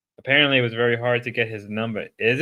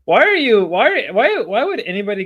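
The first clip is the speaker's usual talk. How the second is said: loud, high repeated "why"; incredulous yell; 10 semitones higher, 8 dB louder than usual. Real, not acted. Streamer baffled by a player's move, loud and high. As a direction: demand an answer, baffled.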